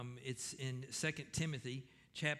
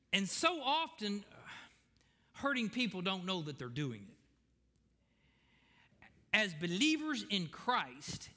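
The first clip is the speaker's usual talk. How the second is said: preaching; 6 semitones higher, 6 dB louder than usual. Acted. Preaching to the congregation.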